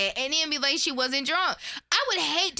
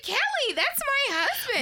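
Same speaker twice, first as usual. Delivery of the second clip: mock Emily; 13 semitones higher than usual. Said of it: "she said".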